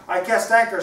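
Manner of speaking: high, loud play call; Vasco shouting. Acted. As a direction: shout it out to playmates. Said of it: "shouted Vasco".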